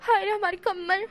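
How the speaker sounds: hammed-up indignant wail; outraged wail. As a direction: build from protest to a loud lament. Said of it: "इस तरह से wing बोलता है".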